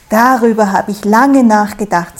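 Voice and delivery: loud, puffed-up pride; proud. A narrator's reading. Stroh proudly announcing his plan to Sau, loud. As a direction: boast it like a proud little straw. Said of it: "voller Stolz".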